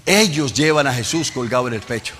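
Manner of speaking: loud, rising shouted sermon cadence; impassioned Pentecostal preaching, shouting to the congregation; full-force preaching. Acted. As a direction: preach it out, building to a roar.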